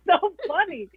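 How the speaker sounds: words broken by giggles; laughing. Real, not acted. Castmate laughing while recalling the meeting. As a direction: laugh through it, real joy. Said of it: "I was losing my mind".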